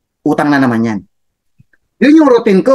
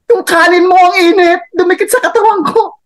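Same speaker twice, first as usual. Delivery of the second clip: high, tearful whiny complaint; whining complaint; 13 semitones higher than usual. Retold or imitated. Burned LRT passenger complaining to seatmate, high whine. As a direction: whimper that the rice burned you.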